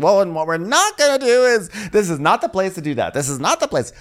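high, indignant telling-off; feisty. Retold or imitated. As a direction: imitate an affronted woman laying down the rules. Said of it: "Drew gets all feisty".

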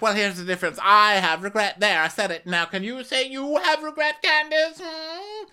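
rising high mock challenge; mock Karen. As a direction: needle her in a high sing-song. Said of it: "she's like".

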